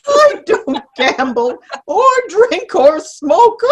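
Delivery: said through laughter, slightly high; laughing. Real, not acted. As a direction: deny it while still giggling. [name] laughing through her denial.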